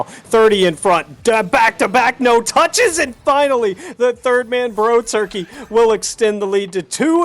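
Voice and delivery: rising, excited play-by-play call; excited goal call. Real, not acted. Goal call building to climax.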